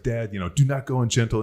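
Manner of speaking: pleading like a son rallying his father; earnest mock-rousing recital; impassioned plea. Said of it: "I had this, like, impassionate deal".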